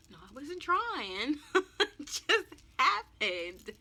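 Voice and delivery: high, drawn-out words through laughter; laughing. Retold or imitated. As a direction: protest slowly while cracking up, loud. Laughing at own reply to mother.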